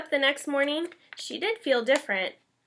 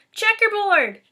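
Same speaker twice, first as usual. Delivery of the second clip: loud, high shout; 10 semitones higher, 8 dB louder, slower than usual. A narrator's reading. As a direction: shout it across the room. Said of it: "Someone else shouted".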